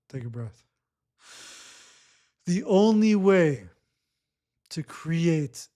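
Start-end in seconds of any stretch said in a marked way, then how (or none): 0.1-0.6 much softer, lower, gentle cue; soft breathing cue. Real, not acted. Coach guiding a client into a breath, soft and low. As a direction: drop the voice to a soft invitation.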